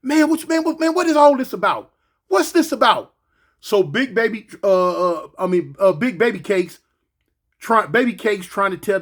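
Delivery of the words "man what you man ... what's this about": high mock guard questioning; lightly voiced guard asking; questioning officer. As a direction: press him like a tired officer. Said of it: "hear the police telling".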